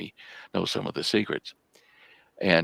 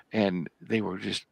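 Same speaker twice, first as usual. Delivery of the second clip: usual speaking voice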